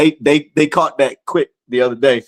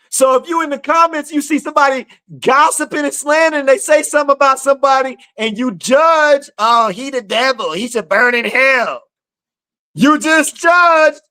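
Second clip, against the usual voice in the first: raised, insistent sermon voice; preaching; 9 semitones higher than usual. Acted. Preacher warning commenters about judging, raised and insistent. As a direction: press the point on the listeners.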